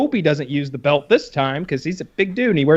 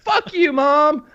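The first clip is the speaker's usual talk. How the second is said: mock kid shout; 10 semitones higher, much slower than usual. Kid cursing at mom.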